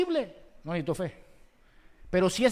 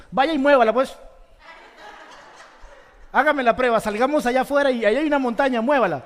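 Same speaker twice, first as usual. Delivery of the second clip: louder, teasing challenge with a laugh; loud playful dare; 5 semitones higher, 9 dB louder, faster than usual. Real, not acted. Preacher daring the congregation with a loud teasing challenge. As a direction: dare them to go and do it.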